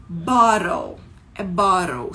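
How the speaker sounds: "bottle" with T turned to a flap; tapped R-like T in "bottle"; American "bottle". Shown as a demonstration. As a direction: model "bottle" as "baw-rol", emphatically. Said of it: "não é bottle que fala".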